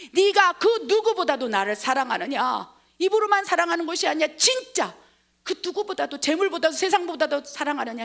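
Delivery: 크고 높게 거듭 따져 묻기; 확답을 구하는 예수 연기; 힘주어 묻기. Acted. 부활한 예수가 베드로에게 물음. (목소리에 힘을 실어)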